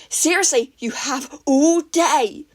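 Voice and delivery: loud, slow, sarcastic shout; angry shout. A narrator's reading. Katsuki yelling sarcastically at Izuku, loud. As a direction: drag out the sarcasm.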